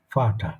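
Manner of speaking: "ফাটা" with an initial F sound; German V as F. Shown as a demonstration. Teacher gives a native German word, F sound. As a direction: model "ফাটা" starting on F, not V. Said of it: "ফয়ের মতন উচ্চারণ হবে".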